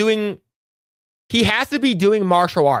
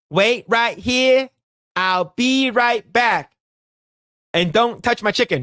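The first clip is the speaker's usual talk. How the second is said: quoting a movie cop; 5 semitones higher, slower than usual. Retold or imitated. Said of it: "he's like".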